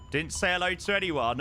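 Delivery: usual speaking voice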